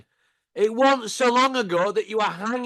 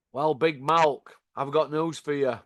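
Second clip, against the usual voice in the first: ordinary talk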